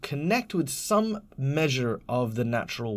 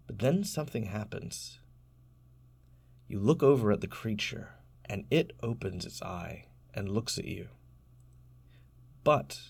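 hushed game master narration; 3 semitones lower than usual. Acted. Game master describing the sleeping creature to Trevor, hushed and suspenseful. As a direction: draw the players in with a hush.

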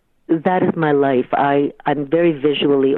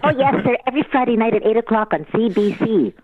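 put-on little girl voice; 7 semitones higher, faster than usual. Little girl answering officer.

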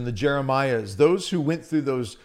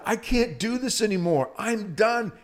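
higher, anguished cry of despair; 7 semitones higher than usual. Retold or imitated. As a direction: cry out to God, worn out. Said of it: "the attitude and the tone".

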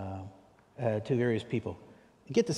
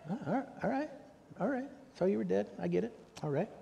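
flustered conceding; 10 semitones higher, faster than usual. Retold or imitated.

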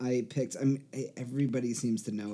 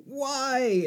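high, drawn-out "why"; high-pitched plaintive question; mock dismayed outcry; 12 semitones higher, 4 dB louder than usual. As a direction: imitate someone appalled by a sip. Retold reaction to tasting the drink.

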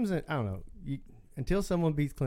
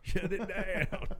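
high, slow, drawn-out coach voice; hammed-up Coach O, slowed down; slow Coach O echo; 5 semitones higher, 3 dB quieter, much slower than usual. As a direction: savor it like the coach. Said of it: "in his accent".

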